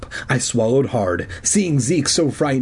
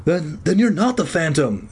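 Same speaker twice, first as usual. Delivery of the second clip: higher, hesitant question; uncertain; 5 semitones higher than usual. A narrator's reading. Zeke checking who the man really is, uncertain. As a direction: falter into the question.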